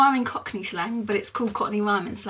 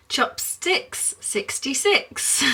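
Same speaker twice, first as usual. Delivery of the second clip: long drawn-out call, rising at the end; old bingo caller; 5 semitones higher, much slower than usual. Retold or imitated. Old-time bingo caller calling a number to the hall, drawn-out sing-song. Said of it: "that's how they used to say it when you went to bingo".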